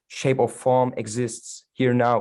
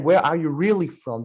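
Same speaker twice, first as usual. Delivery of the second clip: higher, louder, mock insistent questioner; pushy question; 5 semitones higher, 4 dB louder than usual. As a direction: imitate them pressing the question. Retold question about her origins.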